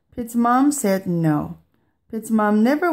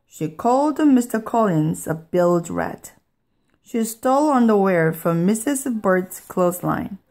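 usual speaking voice

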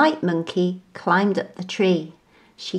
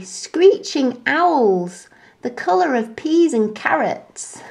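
alarmed monkey; 6 semitones higher than usual. A narrator's reading. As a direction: stretch out the scary words. Frightened monkey.

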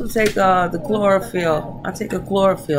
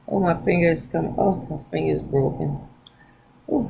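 usual speaking voice

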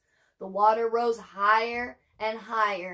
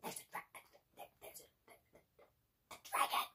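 awed whisper; 13 dB quieter, faster than usual. Acted. Reader awed at the dragon reveal.

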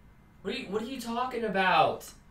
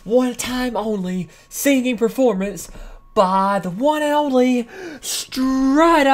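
slow, loud hype announcer intro; 2 semitones higher, 9 dB louder than usual. Acted. Host hyping up the band's one-time show, loud announcer voice. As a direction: announce the act like a big stage host. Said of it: "Bringing you a live".

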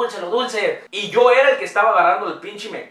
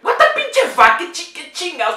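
high, loud exasperated exclamation; outraged outburst; 13 semitones higher, 5 dB louder than usual. Real, not acted. Reacting to the silly motivational phrase with a loud outburst. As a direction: explode in disbelief at the phrase.

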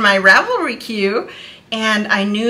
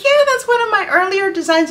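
high, louder shopkeeper voice; imitated designer; 12 semitones higher, 5 dB louder, faster than usual. Designer confirming her work to a fan, high imitated voice. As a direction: voice her bright reply up high. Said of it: "She's like".

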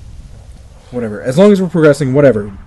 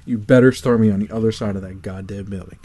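ordinary talk

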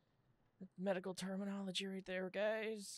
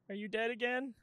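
usual speaking voice

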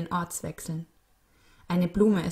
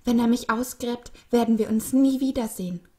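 loud, high-pitched dread; raised voice, desperate with fear; panicked; 6 semitones higher, 8 dB louder than usual. A narrator's reading. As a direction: cry out in panic at being parted. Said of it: "Die beiden hatten Panik".

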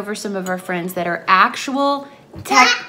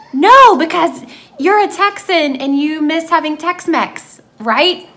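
imitating eager friends; 7 semitones higher, 9 dB louder than usual. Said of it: "they're like".

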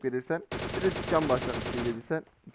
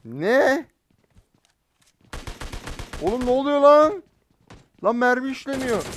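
high, loud startled outburst; loud, shocked gamer outburst; shocked disbelief; 9 semitones higher, 6 dB louder than usual. Real, not acted. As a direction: blurt it out in total shock. Bullets not hitting the enemy.

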